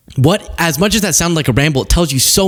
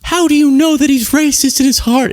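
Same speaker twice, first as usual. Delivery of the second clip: high, whiny mock of the defenders; 10 semitones higher than usual. Host mocking Trump's defenders, high and whiny. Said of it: "his defenders came out and said".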